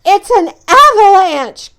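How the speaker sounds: high, loud panicked cry; alarmed loud shout; cried out in alarm. Acted. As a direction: yell the warning. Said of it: "cried Toad".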